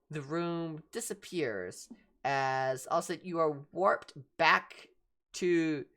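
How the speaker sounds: normal talking voice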